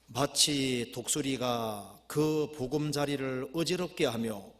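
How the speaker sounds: slow, drawn-out verse reading; scripture reading. Acted. Preacher reading Deuteronomy to the congregation, measured solemn recitation. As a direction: intone each phrase deliberately.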